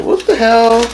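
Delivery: high shocked outburst; disbelieving what the hell. Real, not acted. Shocked at the bread.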